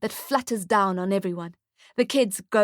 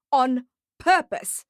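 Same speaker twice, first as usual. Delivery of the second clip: ON PURPOSE drawn out, higher pitched; slow emphatic accusation; 4 semitones higher, 3 dB louder, slower than usual. A narrator's reading. Lowe blames the elf, "On purpose" slow and emphatic. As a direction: weigh out "On purpose" slowly, word by word.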